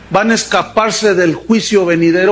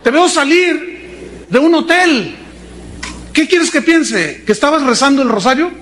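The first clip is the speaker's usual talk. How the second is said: high, indignant wife voice; mocking outraged wife, pitched high; wife catching cheater; 7 semitones higher than usual. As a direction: play the betrayed wife, high and incredulous. Preacher acts wife catching husband.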